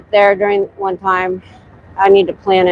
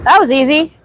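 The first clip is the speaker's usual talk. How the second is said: sarcastic; 9 semitones higher than usual. Real, not acted. Sarcastic about getting lost.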